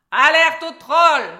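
very loud, high, drawn-out shout; crowd's alarm cry. A narrator's reading. Troll imitates everyone who meets him, shouting the alarm. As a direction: yell the alarm, stretching it out. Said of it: "ils crient tous".

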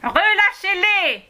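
put-on high, slow, loud command from an old woman; shout. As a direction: yell it as an old woman ordering release. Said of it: "hurla la vieille femme".

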